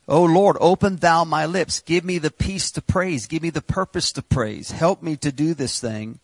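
high, loud pleading prayer; preacher praying at full voice; fervent prayer. Acted. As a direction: cry out to God.

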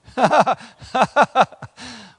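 loud laugh. Real, not acted. Laughs at verse.

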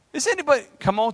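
normal talking voice

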